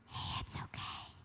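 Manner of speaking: soft, coaxing whisper; sly whisper. Shown as a demonstration. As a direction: whisper it like a tempting voice in your ear. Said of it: "It's not gonna come quiet".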